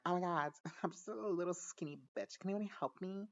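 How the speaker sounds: put-on high, pleading girly voice; mock helpless girl. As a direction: plead for help as a hammed-up damsel. Acts out girl pleading for help.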